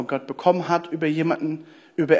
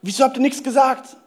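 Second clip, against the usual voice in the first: angry outburst; 7 semitones higher than usual. Retold or imitated. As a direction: blurt out the betrayal. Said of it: "Und ich war so sauer".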